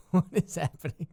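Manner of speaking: laughing in disbelief. Real, not acted. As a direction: laugh through the question. Laughing at something on screen.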